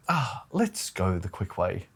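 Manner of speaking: soft, slow and offhand; casual dad quote. Retold or imitated. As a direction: toss it off lazily. Dad's casual decision, retold.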